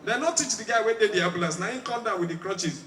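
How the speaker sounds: ordinary talk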